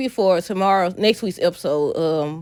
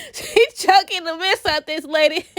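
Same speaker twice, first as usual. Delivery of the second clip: laughing; 9 semitones higher than usual. Real, not acted. Laughing while describing the scene.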